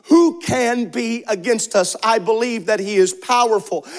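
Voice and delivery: normal talking voice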